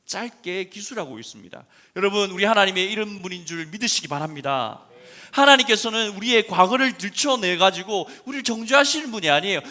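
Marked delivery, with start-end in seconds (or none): 2.0-9.6 loud, high fervent preaching; preaching at full force. Acted. Pastor declaring God's mercy, fervent loud preaching. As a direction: declare it to the congregation.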